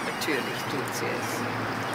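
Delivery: a slow hushed verdict; cold whisper. Acted. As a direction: say it quietly, coldly sure. Cold certainty about the mother.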